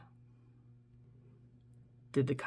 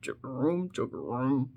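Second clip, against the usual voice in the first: a hint of a croaking bullfrog; bullfrog call; 3 semitones lower, 5 dB quieter than usual. Shown as a demonstration. Telling viewers what bullfrogs say, lightly croaked call. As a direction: mimic a bullfrog's deep call. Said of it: "make that noise like a bullfrog".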